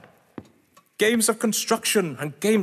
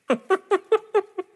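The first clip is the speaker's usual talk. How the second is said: short high amused laugh; 12 semitones higher than usual. Acted. Suspect laughing off the inspector's questions, high amused laugh. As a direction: laugh it off, as if the whole affair is a joke. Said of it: "find all this so funny".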